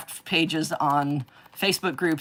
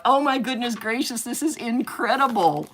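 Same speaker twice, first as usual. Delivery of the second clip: ordinary talk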